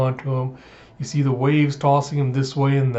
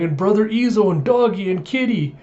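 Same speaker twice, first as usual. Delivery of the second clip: high-pitched mock wail; a little kid listing who is gone, lightly acted; imitated crying child; 6 semitones higher than usual. Small child missing brother and pets, mock crying. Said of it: "Baby Kiku's just crying".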